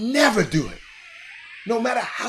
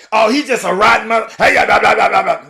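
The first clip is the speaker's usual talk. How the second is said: mocking; 3 semitones higher, 12 dB louder than usual. Mocking what people say about him.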